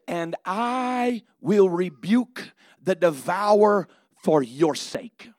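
loud and slow, each word weighed; slow, emphatic God voice. Acted. Preacher speaking as God to the congregation, slow and emphatic. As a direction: declare it slowly and firmly.

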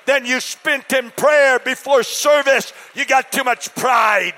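loud, high-pitched preacher's shout; full-throated sermon shout; preaching at full force. Real, not acted. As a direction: thunder it from the pulpit.

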